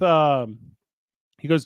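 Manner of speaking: ordinary talk, nothing marked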